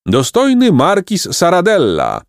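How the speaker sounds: high, heralding shout; high, proud acclamation; crowd cry. A narrator's reading. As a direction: announce him loftily from the crowd. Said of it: "zakrzyknięto w tłumie".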